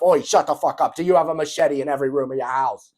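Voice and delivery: hammed-up British tough guy interrupting; mock Andrew Tate British shout. Andrew Tate cutting Ashton off, loud mock British demand. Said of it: "He's like".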